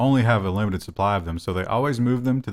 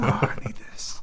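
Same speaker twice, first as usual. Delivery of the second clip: mock whisper; 5 dB quieter than usual. Acting a customer scratching at counter.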